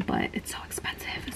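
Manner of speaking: unvoiced whispered aside; whispering. Real, not acted. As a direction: drop to a whisper. Aside about the price.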